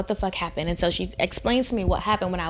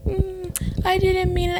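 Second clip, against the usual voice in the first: high, whiny mock pout; pouting boyfriend; 10 semitones higher than usual. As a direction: imitate a sulky man making excuses. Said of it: "now he's starting to pout".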